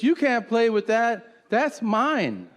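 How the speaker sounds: put-on high, slow possessive kid; mock a bossy older child, pitched high. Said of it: "and an older kid".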